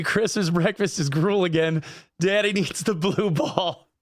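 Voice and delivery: slow, hammed-up lament; a poor dad slowly pleading for the blue ball; slow mock lament. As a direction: drag it out slowly like a pitiful dad. Poor dad joke after apology.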